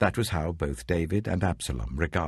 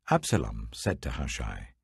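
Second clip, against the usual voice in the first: usual speaking voice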